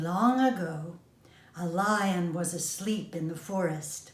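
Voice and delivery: ordinary delivery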